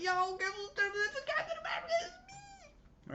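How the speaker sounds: very high, fast, excited squealing; gleeful bragging squeal. Pretend. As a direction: squeal the big news to your boys. Acts out gleeful call to friends.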